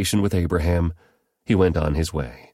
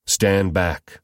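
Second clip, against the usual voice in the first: ordinary delivery, nothing marked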